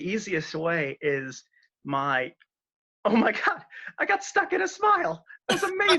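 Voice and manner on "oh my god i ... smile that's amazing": much higher, squealing through a laugh; giggling in delight at a frozen smile. Real, not acted. Guest to host about his frozen video smile, squealing with laughter.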